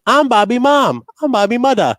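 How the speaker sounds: high, loud mother voice; high mom impression. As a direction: squeak it out like his mom. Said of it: "makes fun of his mom".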